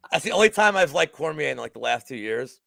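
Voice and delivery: ordinary talk, nothing marked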